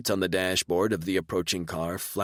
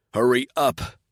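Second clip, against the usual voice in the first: loud sharp hurry up; urgent shout; 5 semitones higher, 7 dB louder than usual. Acted. Guard urging the narrator on, loud and urgent. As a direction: bark it urgently.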